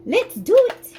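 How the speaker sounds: high, loud rallying cry; pumped up. Real, not acted. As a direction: hype yourself up to start. Psyching herself up.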